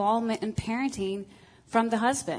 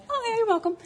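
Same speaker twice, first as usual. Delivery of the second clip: much higher, sing-song mock innocence; wife retells her own clueless answer to her husband, much higher; mock oblivious reply; 14 semitones higher, faster than usual. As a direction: play it breezy and clueless, pitched way up. Wife's oblivious reply, retold.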